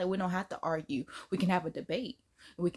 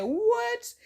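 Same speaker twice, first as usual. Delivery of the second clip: shocked what; 12 semitones higher, 5 dB louder than usual. Retold or imitated. Shocked reaction quote.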